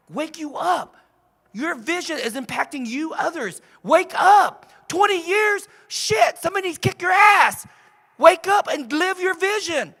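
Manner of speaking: high, loud tough-love exhortation; forceful high-pitched coaching shout; wake-up yelling. Shown as a demonstration. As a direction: yell at them to wake up. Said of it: "They love me for yelling".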